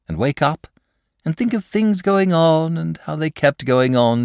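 high, chatty dragon voice; a lazy, cheerful dragon confiding; genial dragon. Acted.

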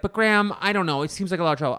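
whiny reluctance. Retold or imitated. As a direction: resist it, loud and high. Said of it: "And he's like".

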